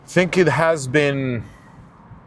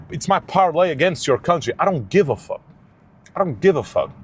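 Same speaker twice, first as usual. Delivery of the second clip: usual speaking voice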